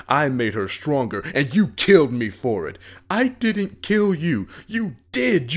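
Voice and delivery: screaming rant at the brother who let him die; furious. Acted. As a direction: unload years of rage at your brother. Dead brother's rising furious rant.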